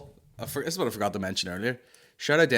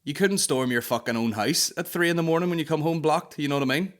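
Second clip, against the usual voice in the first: ordinary talk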